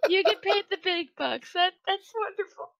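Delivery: high, loud teasing through laughter; mock praise, cracking up; laughing sarcasm. Real, not acted. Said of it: "Can't even keep a straight face".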